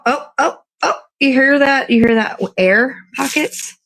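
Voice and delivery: ordinary talk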